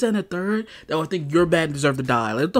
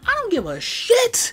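very high, drawn-out angry dismissal; exasperated outburst. Real, not acted. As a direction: explode at the imagined critic. Angrily dismissing the imagined objection.

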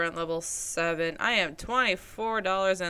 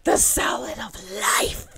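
whispering; 3 semitones higher, 6 dB louder than usual. Pretend. Spooky whispered tagline.

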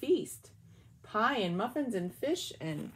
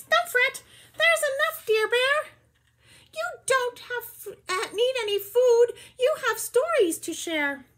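very high, louder squeaky mouse voice; 9 dB louder than usual. Acted. Mouse comforting the sad bear, high squeaky reassurance. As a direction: squeak it like a tiny kind mouse. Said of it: "Mouse squeaks".

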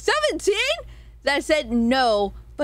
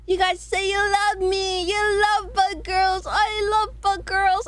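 high, drawn-out mock declaration; hammed-up declaration; 7 semitones higher, slower than usual. Streamer segues to chat about poll results, hammed-up and drawn-out. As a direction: stretch each phrase slowly, pitched well up.

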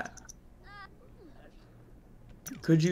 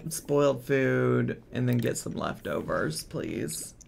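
ordinary talk, nothing marked

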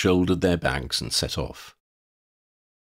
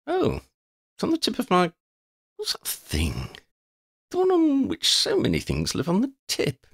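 high, eccentric vagabond voice, halting as he searches; scatterbrained wanderer; 13 semitones higher than usual. Acted. Forgetful wanderer introducing himself to the children, scatterbrained. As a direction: play a forgetful wanderer groping for a word.

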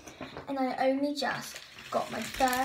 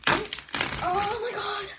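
high, breathy "oh my god"; real nerves; nervous gasp; 8 semitones higher, 4 dB louder than usual. Real, not acted. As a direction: gasp at the suspense. Nervous before the reveal.

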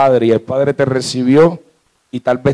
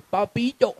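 put-on high, soft little-girl pleading; little daughter's voice; 8 semitones higher, 9 dB quieter than usual. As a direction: coax Daddy in a tiny, high voice. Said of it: "ojitos y me dice". (vocecita aguda de niña mimosa)

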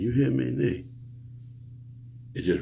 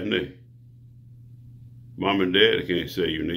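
ordinary talk, nothing marked

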